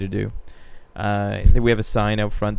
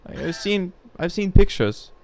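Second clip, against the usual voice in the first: put-on slow, high German accent; lightly put-on German accent; 6 semitones higher, 3 dB quieter, much slower than usual. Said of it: "he speaks in a German accent".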